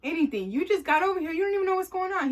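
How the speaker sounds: exasperated disbelief. Retold or imitated. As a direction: push back, can't believe this guy. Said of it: "I'm talking to him just like this".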